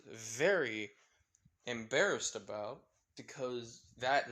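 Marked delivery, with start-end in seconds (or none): none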